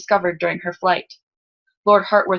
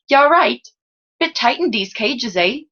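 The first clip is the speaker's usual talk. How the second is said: higher-pitched, friendly lower-class Salzen lad; lower-class Salzen accent; 5 semitones higher, 3 dB louder than usual. A narrator's reading. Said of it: "belonged to the lower class of Salzen".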